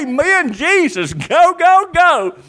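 high, loud urging; excited, punchy cheer. Acted. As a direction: egg him on like a fan, pitch jumping up. Preacher acts cheering Jesus on.

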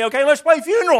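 high, loud eager call; a kid trying the next game with gusto; preacher voicing a child shouting a new game. Children's game quarrel acted out.

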